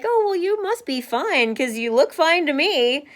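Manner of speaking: breezy, high voice brushing it off; light mock of people who dismiss you; dismissive mock. Imitating others who say she looks fine, breezy and high. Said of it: "they're like".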